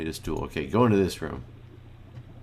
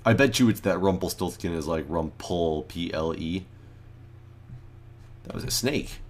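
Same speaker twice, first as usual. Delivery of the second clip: usual speaking voice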